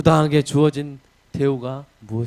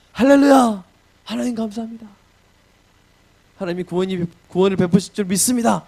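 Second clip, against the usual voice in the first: joyful praise; 6 semitones higher than usual. Acted. Paul praising God in the jail, jubilant shout. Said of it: "찬양을 했다".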